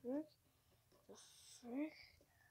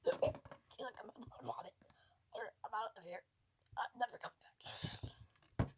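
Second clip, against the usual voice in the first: shaken whisper; 3 semitones lower, 9 dB quieter than usual. Real, not acted. Quitting the game in fear.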